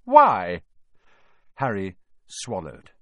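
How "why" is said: one loud, pointed question; suspicious. A narrator's reading. McGonagall questions the pupils' request, loudly suspicious. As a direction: demand a reason, smelling something fishy. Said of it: "this was a very fishy thing".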